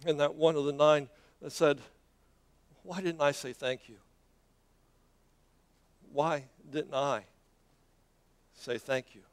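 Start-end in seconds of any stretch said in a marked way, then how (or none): 2.8-4.0 soft question in one run; quiet regret. Acted. One of the nine to himself, quietly regretful. 6.1-9.2 soft, low and slow, long pause before "say thank you"; slow, pondering regret. Acted. One of the nine to himself, slow and pondering.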